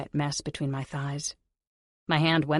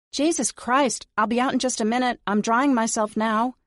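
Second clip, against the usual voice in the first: higher and louder, flustered call; exasperated shout; 8 semitones higher, 5 dB louder than usual. Acted. Heroine yelling at the panicking maids, exasperated. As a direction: yell back to hold them off.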